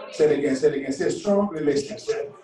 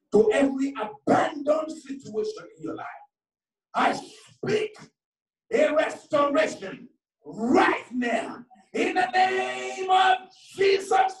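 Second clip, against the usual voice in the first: high, impassioned preacher's cry; fervent preaching, pitch soaring; prophetic declaration; 12 semitones higher, 3 dB louder than usual. Real, not acted. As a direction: declare it over them, stretching the final words. Prophesies over the congregation.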